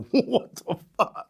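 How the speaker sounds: slow, drawn-out disbelief; stunned disbelief. Retold or imitated. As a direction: stretch it out, incredulous. Stunned reaction to freed dealer's story.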